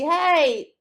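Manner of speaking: short, high, loud mock yell; imitated dog bark-yell. Owner voicing Zaina shouting at her, a loud mock yell. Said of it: "screaming at me".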